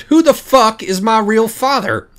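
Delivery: slow, high, loud demand; almost shouting. A narrator's reading. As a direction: blurt the question near a shout. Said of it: "all but shouted".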